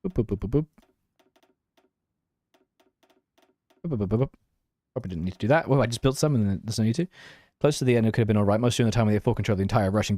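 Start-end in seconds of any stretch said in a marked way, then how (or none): 0.1-4.3 light sing-song boops with each click; playful boops. Pretend. Streamer making boop sounds while clicking buildings, playful sing-song. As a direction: mouth little boop noises in a bouncy rhythm.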